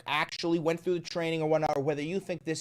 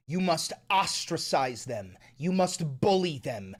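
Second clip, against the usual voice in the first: ordinary talk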